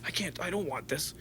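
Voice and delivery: near-whispered self-talk; hushed inner voice. Retold or imitated. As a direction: quietly tell yourself you don't want this. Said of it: "I was like".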